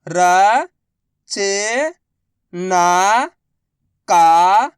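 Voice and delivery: very slow, each syllable drawn out. Shown as a demonstration. Teacher sounding out a word for pupils, slow syllables. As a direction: spell the word out slowly for a child. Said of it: "मैं किस तरीके से".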